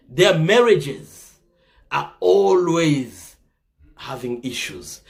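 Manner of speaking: each word drawn out slowly, then dropping away; slow it right down to make the point; slowed emphasis. Real, not acted. Speaker warning listeners about failing marriages, slow and heavy.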